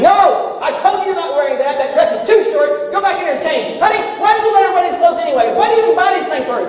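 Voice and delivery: hammed-up furious father; imitating a yelling dad. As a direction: scold the daughter and wife like an angry father. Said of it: "Dad yelling at her saying".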